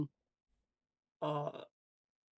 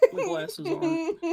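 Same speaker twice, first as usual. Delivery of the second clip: high laughing squeak; words squeezed out through real laughter; giggling correction; 14 semitones higher, 8 dB louder than usual. Real, not acted. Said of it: "repeats laughing over glasses".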